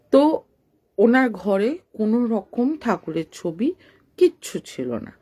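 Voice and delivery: usual speaking voice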